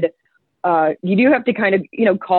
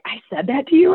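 higher-pitched, incredulous echo; retold disbelief; 7 semitones higher than usual. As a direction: act out his astonished question. Coworker astonished at his own remark.

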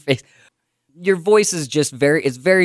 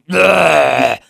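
throaty ugh; 3 semitones higher, 6 dB louder than usual. Shown as a demonstration. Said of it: "throaty and nasally at the same time".

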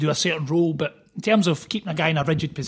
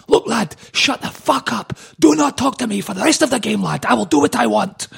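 retold furious outburst; 6 semitones higher, 5 dB louder than usual. Gravesen going ballistic at a teammate on the pitch, mock furious shout. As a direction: imitate a player blowing up at his teammate. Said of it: "this time he went ballistic".

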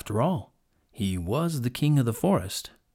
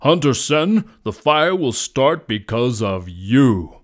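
loud, slow, weighty accusation; commanding lion; 9 dB louder, slower than usual. A narrator's reading. Lion blames the hunter, loud and commanding. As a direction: boom the blame at him. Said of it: "commanded the lion".

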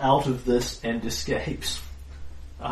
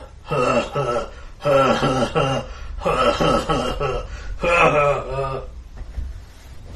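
confident troll laugh. Acted. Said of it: "laughs very confidently".

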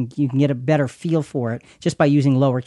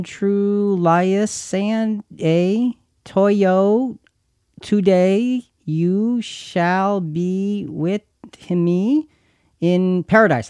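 very slow, word-by-word stumbling read; slow halting decoding of run-together text; halting all-caps read; 4 semitones higher, much slower than usual. Shown as a demonstration. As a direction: sound out an unspaced string of letters, slowly. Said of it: "all capitals with no spaces".

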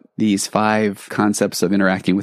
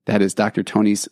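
normal talking voice

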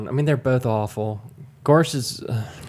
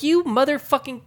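angry outburst; 12 semitones higher than usual. Retold or imitated. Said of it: "I'm like".

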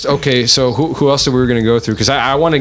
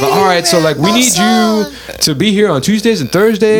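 high, chirpy, bossy neighbor; eager neighbor voice; 7 semitones higher than usual. Retold or imitated. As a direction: lay out the schedule like a busybody. Voicing the community's demands.

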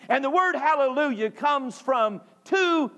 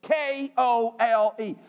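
normal talking voice

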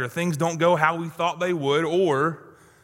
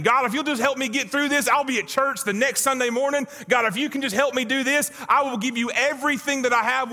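pleading prayer; 8 semitones higher than usual. Acted. Voicing our plea to God.

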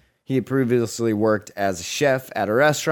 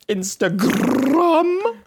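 drawn out; 12 semitones higher, much slower than usual. Pretend. Drawn-out list ending.

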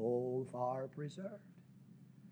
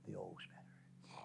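whisper; 18 dB quieter, slower than usual. Retold or imitated. Last repeat whispered.

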